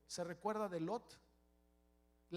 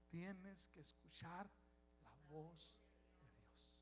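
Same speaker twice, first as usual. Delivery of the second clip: hushed counsel; 3 semitones lower, 17 dB quieter, slower than usual. Acted. Pastor softly intoning counsel.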